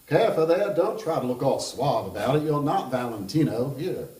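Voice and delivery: Kentucky charmer mocking a clumsy smoker; Kentucky accent. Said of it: "I'm going to attempt that kind of accent".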